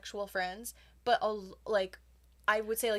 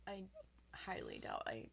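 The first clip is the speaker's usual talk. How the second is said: whispering; 13 dB quieter than usual. Real, not acted. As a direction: whisper it back. Whispered reply.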